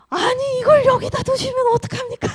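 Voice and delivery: high, sharp mock scolding of her husband; retold angry outburst. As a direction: replay her angry protest, half-laughing. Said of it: "제가 막 화를 냈어요". (화내며 따지는 말투 흉내)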